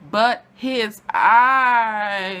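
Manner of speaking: drawn-out dreamy sigh of a line; swooning. Real, not acted. A fan swooning over Count Paris's eyes, slow and dreamy.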